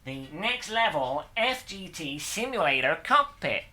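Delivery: peppy American presenter intro; American accent, hammed-up tutorial host delivery; put-on American accent. Builder presents the cockpit build to viewers, American accent. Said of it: "best American accent I could do".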